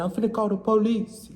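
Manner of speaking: put-on high-pitched botched Arabic accent; attempted Arabic accent. Storekeeper threatens to call police, mock Arabic accent. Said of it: "No, that's wrong accent".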